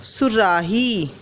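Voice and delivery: very slow, joining the syllables; slow blended word. Shown as a demonstration. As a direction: stretch it out for the class. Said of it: "तो हम इसको सा के नीचे लगाएंगे".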